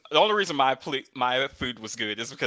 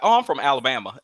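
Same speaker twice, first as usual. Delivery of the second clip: put-on country accent; 2 semitones higher, 7 dB louder than usual. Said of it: "Alabama brings out my country accent".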